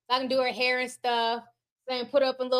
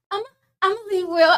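fast, loud, dramatic catchphrase; hammed-up catchphrase, sped up; catchphrase on request; 6 semitones higher, 5 dB louder, much faster than usual. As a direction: blurt it out in full drama. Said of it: "but can you say, 'I'ma leave Will'?".

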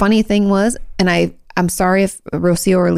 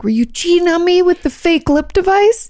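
much higher, hammed-up indignation; high, playful jealous outburst; mock jealous accusation; 12 semitones higher than usual. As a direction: accuse a cheating lover in mock outrage. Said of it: "you're like".

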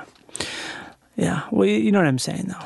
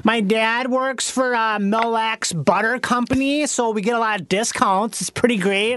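caricatured Wisconsin bikini contestant; chatty mock Upper Midwest accent; 2 semitones higher than usual. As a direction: lay the Wisconsin vowels on thick. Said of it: "has that accent?".

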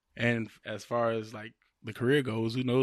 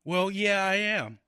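slightly raised, higher character voice; lightly acted movie girlfriend; 5 semitones higher than usual. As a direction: play the nervous fiancée, pitched up. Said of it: "I'm gonna go with Marissa Tomei's character".

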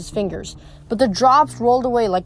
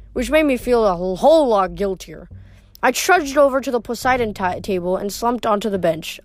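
plain reading voice

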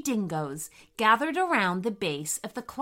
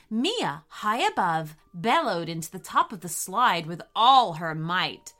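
usual speaking voice

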